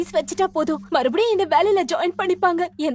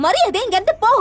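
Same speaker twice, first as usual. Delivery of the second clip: louder and higher, spitting each word out; angry repeat; 5 semitones higher, 4 dB louder than usual. Acted. Rival repeats her order at a louder, higher shout. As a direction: shout it again since she won't listen. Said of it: "உனக்கு காது கேக்காதா?".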